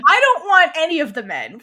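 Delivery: acted-out fed-up objection; exasperated. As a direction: protest it with loud exasperation. Acts out Mon's exasperated reply.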